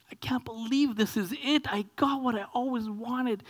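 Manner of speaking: high-pitched, overcome with wonder; acted disbelief. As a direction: play a kid overwhelmed by a dream gift. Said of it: "you could hardly believe it was real".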